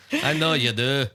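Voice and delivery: hammed-up Irish brogue, joking; Irish accent, playful. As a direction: put on a cheeky Irish brogue for the friends. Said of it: "All right, go".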